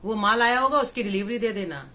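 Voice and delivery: loud opening call, settling by the end; acted shout to someone across the house; calling out mid-prayer. Man at his puja ordering a delivery, calling out. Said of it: "साथ साथ में कह रहे हैं".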